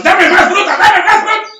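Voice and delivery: put-on high, loud childish yelling; tantrum shout. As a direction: shout like a kid throwing a tantrum. Cain shaking the tree in rage.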